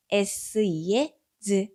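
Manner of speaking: 즈 drawn out very slowly; slow letter sounds. Shown as a demonstration. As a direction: stretch the 즈 sound. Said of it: "즈가 합쳐지면". (즈를 길게 늘여서)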